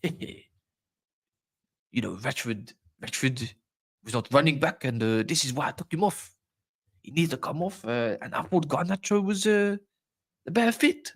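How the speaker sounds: halting Dutch-South African accent impression; mock Dutch accent, hesitant and broken up; Ten Hag. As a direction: mimic a Dutch manager explaining himself, with South African twang. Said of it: "the way he says Rashford".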